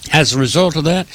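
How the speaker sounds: usual speaking voice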